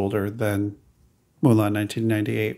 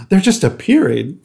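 gasped, lightly raised excited reaction; retold delight; 7 semitones higher, 4 dB louder than usual. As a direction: replay your delighted amazement, a bit higher.

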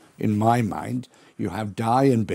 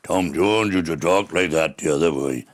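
lower, louder comic partner's voice; Tom's voice; 7 semitones lower, 4 dB louder than usual. Retold or imitated. Recalling the Tommy Trouble sketch, lower louder Tom. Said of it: "And Tom".

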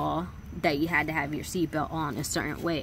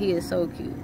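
usual speaking voice

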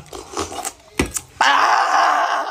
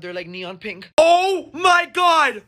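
ordinary talk

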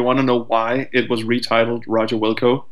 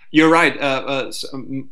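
ordinary delivery

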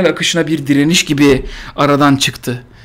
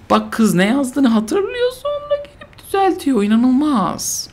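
slow, pitch leaping much higher in fake awe; mock amazement; 10 semitones higher, slower than usual. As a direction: gush over it in fake wonder. (sahte hayranlıkla, ince ve yavaş)

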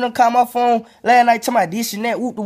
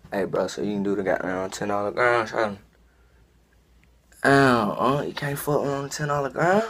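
a much lower, softer hustler voice; low haggling dealer; 12 semitones lower, 6 dB quieter than usual. Acted. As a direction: lowball the price in a low, softer voice. Sketch character haggling over price.

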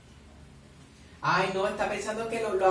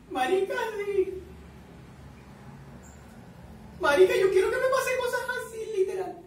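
emoción; 12 semitones higher, 4 dB louder than usual. Real, not acted. Thrilled by the scene.

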